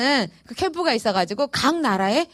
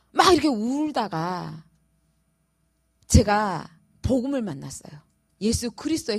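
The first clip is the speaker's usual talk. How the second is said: ordinary delivery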